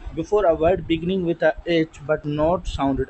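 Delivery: slow, word by word rule reading; slow dictation. Shown as a demonstration. Teacher dictating the rule to students, slowly. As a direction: read it out slowly so students can copy.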